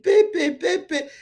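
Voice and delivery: mocking the airline. Host mocks the airline's endless demands, high and chanting. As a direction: nag like a greedy airline. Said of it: "they will say".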